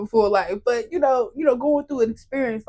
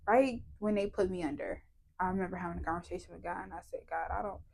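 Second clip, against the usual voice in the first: hushed; 4 semitones lower, 11 dB quieter, faster than usual. Real, not acted. Memory of going under.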